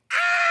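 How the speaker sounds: long, very high, loud scream; sustained piercing squeal at the top of the voice; breakdown screech. Retold or imitated. Phone app feedback breaking down, endless high screech. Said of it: "so it just becomes".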